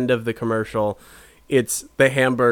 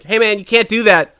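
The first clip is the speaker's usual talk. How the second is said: louder, higher employee objection; protesting employee; 7 semitones higher, 6 dB louder than usual. Acted. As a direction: call out to stop a thief, lightly acted. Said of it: "the employees are like".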